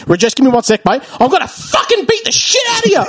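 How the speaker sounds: high, yelled bogan threat; Aussie bogan accent. Retold or imitated. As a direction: threaten him like a rough Aussie bloke. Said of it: "most Aussie bogan accent".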